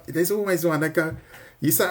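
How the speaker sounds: normal talking voice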